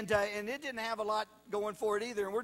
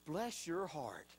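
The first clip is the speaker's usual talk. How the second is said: soft, slow, lower and sweetly pitying; sugary put-down; 5 semitones lower, 8 dB quieter, slower than usual. Retold or imitated. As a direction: coo it like a kind insult. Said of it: "really she was saying".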